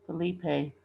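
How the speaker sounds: plain reading voice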